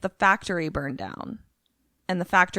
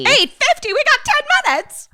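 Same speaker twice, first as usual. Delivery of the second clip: high, loud frantic outburst; high-pitched comic urgency; mock panic; 8 dB louder than usual. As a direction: blurt it in hammed-up alarm.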